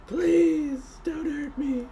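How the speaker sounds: high, frightened little plea; whimpering plea. Acted. Cowering goblin begs again.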